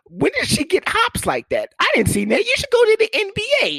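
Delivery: high, loud, disbelieving squeal; mock amazement. Real, not acted. Comic marveling at his girlfriend's sudden hops, squealing in disbelief.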